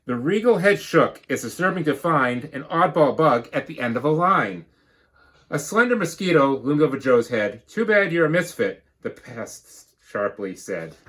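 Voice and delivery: lively storybook read-aloud; bouncy rhyming storybook narration; reading to children. Acted. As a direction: read it like a picture book to the class. Teacher reads picture book.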